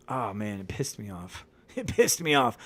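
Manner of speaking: ordinary talk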